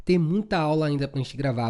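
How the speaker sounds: normal talking voice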